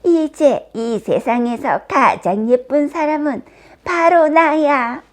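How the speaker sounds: theatrical self-satisfied crowing; gloating satisfaction. A narrator's reading. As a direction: gloat loudly as the victorious queen. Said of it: "흡족해하며 말했어요".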